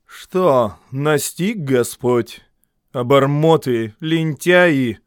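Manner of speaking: loud, scornful scolding; contemptuous. A narrator's reading. The mother-in-law sneers at the family from the window. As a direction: sneer at them. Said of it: "презрительно говоря".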